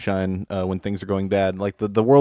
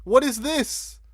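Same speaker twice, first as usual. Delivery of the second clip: stunned disbelief; 12 semitones higher than usual. Real, not acted. Stunned by image on screen.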